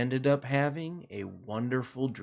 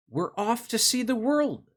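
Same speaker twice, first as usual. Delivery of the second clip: cheerful rooster; 9 semitones higher than usual. Acted. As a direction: call out brightly as the rooster. Rooster voiced in children's story.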